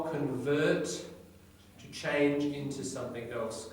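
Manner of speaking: usual speaking voice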